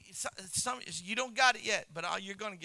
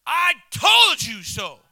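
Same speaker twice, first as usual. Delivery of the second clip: slow, loud gloating told-you-so; Paul's told-you-so shout; 4 semitones higher, 11 dB louder, slower than usual. Retold or imitated. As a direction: shout it slowly, rubbing it in. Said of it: "the King James version of Paul saying".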